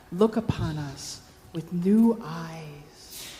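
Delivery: low, soft, earnest pleading; child's gentle plea. Acted. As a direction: appeal tenderly to the sleeping man.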